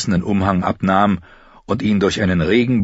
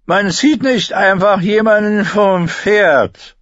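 slow, much higher slurring grumble; drunken archer grumbling at the tourney; drunk complaining archer; 13 semitones higher, 5 dB louder, slower than usual. A narrator's reading. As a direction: complain like a drunk sore loser. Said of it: "beschwerte sich ein betrunkener Bogenschütze".

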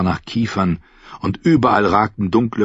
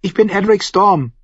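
put-on high, proud child's voice; high-pitched boyish proclamation; announcing boy; 12 semitones higher, 3 dB louder than usual. A narrator's reading. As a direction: announce yourself as a high-voiced young lord. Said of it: "verkündete er".

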